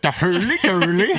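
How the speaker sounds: teasing a friend with his chef puppet bit, hammed-up. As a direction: bounce it like a silly kitchen puppet. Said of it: "the chef puppet".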